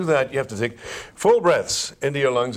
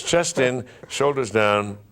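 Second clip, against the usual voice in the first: usual speaking voice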